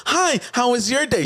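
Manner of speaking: high, mocking small-talk voice; a mocking high voice for the clueless guy.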